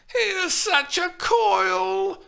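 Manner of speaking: high, loud, slow hammed wail. A narrator's reading. Juliet wails at her nurse, hammed up. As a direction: wail in mock despair. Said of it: "Steph wailed".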